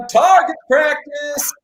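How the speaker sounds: a high, slow, shouted punchline; gleeful shout. Real, not acted. Host shouts his cat joke back at a friend, gleefully. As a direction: crow the joke back at your buddy.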